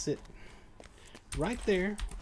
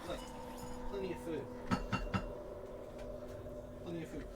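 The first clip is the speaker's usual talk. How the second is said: much softer, gentle coaxing to a pet; 4 semitones higher, 8 dB quieter than usual. Real, not acted. Owner coaxes his cat to eat from the bowl, soft and gentle. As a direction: hush and coax the cat toward the food.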